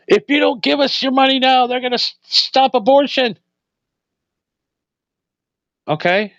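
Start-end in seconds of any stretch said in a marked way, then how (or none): 0.1-3.4 high, hammed-up campaign beg; a politician pleading for your money, in a mock higher begging voice; mock politician pitch. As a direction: wheedle for donations like a sleazy candidate.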